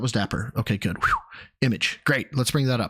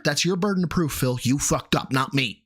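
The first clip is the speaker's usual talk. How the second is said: ordinary delivery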